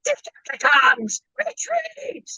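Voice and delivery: high, drawn-out raspy robot villain cry; shrill eighties cartoon villain shout; Chris Latta Starscream impression. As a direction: bark the order like the old cartoon Starscream. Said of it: "Chris Latta did the original voice".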